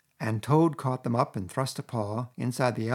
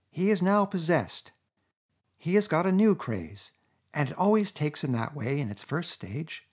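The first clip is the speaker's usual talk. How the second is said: 1.tags: weary Rat; 7 semitones higher than usual. A narrator's reading. Rat explains to Mole.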